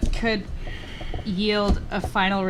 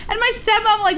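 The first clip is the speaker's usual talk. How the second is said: usual speaking voice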